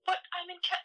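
very high, whiny protest; customer protesting. Retold or imitated. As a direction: cut off mid-protest, high-pitched. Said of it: "Your name is Basil".

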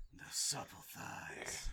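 slow breathy whisper of a creep; creepy whisper. Acted. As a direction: whisper it slowly, leering. Creepy Batman praising Tim.

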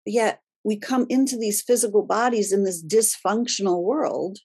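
ordinary talk

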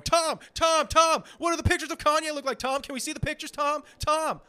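high, loud calling through a door; mock pestering. Hounding a paparazzo at his apartment door, hammed-up pestering. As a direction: holler like an obnoxious reporter hounding someone. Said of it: "I'll be there hammering on his door going".

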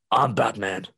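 loud, put-on superhero voice; hammed-up caped crusader impression. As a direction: growl it like a movie vigilante.

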